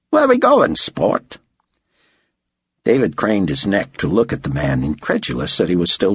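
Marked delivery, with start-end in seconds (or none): 0.1-1.4 high-pitched, breezy Australian; Aussie accent. A narrator's reading. Aussie driver asking his passenger, Australian accent. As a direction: call out like a chummy Aussie driver. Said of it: "his accent making David guess he was an Aussie".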